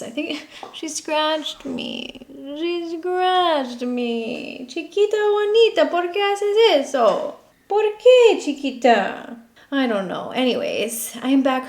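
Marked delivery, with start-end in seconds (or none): 0.0-9.4 high, drawn-out cooing complaint; sing-song, stretched doting reproach; pet talk. Real, not acted.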